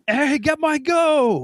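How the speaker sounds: very high, slow drawn-out call; mock call-out. A fan at an indie show calling out to John, high and stretched. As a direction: hail him from across the crowd. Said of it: "someone at, like".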